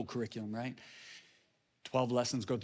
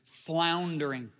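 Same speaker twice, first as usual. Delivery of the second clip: FLOUNDERING drawn out slowly and pitched higher; emphatic repetition; 4 semitones higher, 3 dB louder, much slower than usual. Real, not acted. Preacher stressing the businessman's struggle, "floundering" slow and emphatic.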